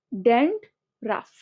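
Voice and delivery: "ড্যান্ড্রাফ" slowly, as dand, then raf; syllable by syllable. Shown as a demonstration. Teacher introducing a spelling word, syllable by syllable. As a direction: leave a gap inside "ড্যান্ড্রাফ".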